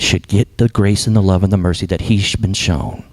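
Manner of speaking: normal talking voice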